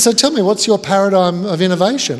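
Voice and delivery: loud, high mock challenge; hammed-up interrogating expert; probing guest. Guest grills him, laughter.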